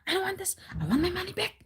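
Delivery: high squeaky mock chorus of complaining customers; mock crowd complaint. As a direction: play the whole queue whining for a refund. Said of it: "everybody was like".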